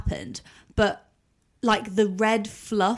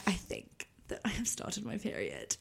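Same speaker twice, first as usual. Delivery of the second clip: hushed; 17 dB quieter than usual. Retold or imitated. Telling mum her period started.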